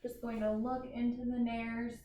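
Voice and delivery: ordinary talk, nothing marked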